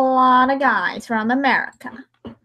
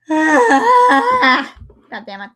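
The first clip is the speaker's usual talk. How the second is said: frustrated swearing; 2 semitones higher, 4 dB louder, much slower than usual. Real, not acted. Frustrated outburst while recording.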